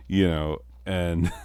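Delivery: usual speaking voice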